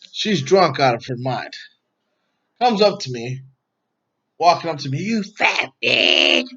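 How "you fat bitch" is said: drawn-out, higher mock screech; mock screeching insult. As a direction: imitate her drunken tirade, stretching every word. Retells the drunk ex's insult.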